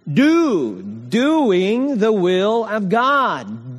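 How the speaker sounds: "Doing the will of God" drawn out slowly, each word leaned on; slow emphatic preaching. Acted. Preacher drives home main point.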